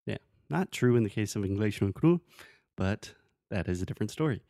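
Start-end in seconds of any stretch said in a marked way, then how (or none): none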